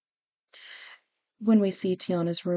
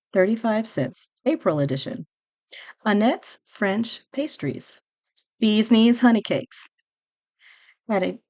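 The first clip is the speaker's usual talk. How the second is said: ad read-out; 4 semitones higher, 3 dB louder than usual. Acted. Host reading vintage magazine ads aloud, announcer style. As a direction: sell each item like a radio ad.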